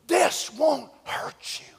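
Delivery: slow breathy whispered reassurance; a hushed, lightly acted whisper; mock whisper. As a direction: coax like a tempter. Quoting hosts' reassurance.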